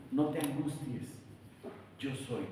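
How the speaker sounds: calm the frightened ones gently; tender reassurance. Acted. God soothing the disciples. (susurro tranquilizador)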